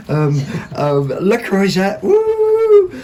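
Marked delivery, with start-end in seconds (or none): 1.1-2.9 higher, gushing over the brand name, then a loud squealed woo; can't contain the thrill at the find; excited. Real, not acted. Host showing off her four-pound Le Creuset kettle, excited squeal. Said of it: "Oh, I'm getting too excited".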